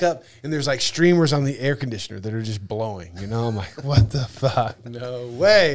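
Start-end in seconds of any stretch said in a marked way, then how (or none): 3.8-5.7 slow, drawn-out "what the fuck, no way"; half-laughing amazement at the absurdity; stunned disbelief. Retold or imitated. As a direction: let the disbelief stretch each word. Disbelief at the blowing streamers.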